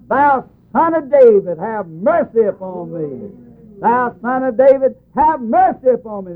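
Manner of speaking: mock beggar's cry, pitched high. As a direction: retell a blind man's high plea for mercy. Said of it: "And he cried out".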